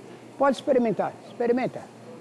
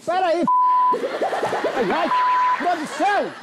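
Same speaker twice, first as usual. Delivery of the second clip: chamando a produção; 6 semitones higher than usual. Real, not acted. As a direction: call the crew for help, urgent. Chama a equipe por socorro. (gritando pela produção)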